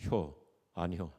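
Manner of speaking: slow, low Chungcheong drawl "효, 아니요". Retold or imitated. Pastor mimics a Chungcheong local answering, slow low drawl. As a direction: draw it out lazily in Chungcheong dialect. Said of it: "한 자, 두 자".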